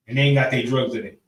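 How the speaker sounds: ordinary talk, nothing marked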